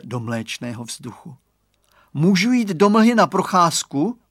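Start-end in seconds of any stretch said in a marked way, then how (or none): 2.1-4.2 put-on eager child. A narrator's reading. Child eagerly asking mother.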